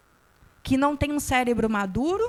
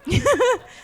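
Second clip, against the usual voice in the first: high laugh; 12 semitones higher, 6 dB louder than usual. Real, not acted. Laughs at the audience.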